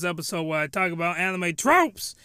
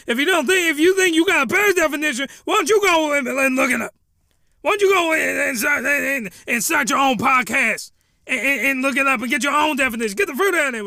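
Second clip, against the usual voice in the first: mocking challenge; 5 semitones higher, 4 dB louder than usual. Mock challenge to critics.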